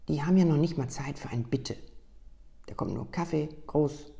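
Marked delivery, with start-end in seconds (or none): 0.1-1.8 defiant retort, louder and punchier. Acted. Coffee girl snapping back about rushed customers, defiant and louder. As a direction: push back stubbornly, chin up. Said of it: "sagte sie trotzig".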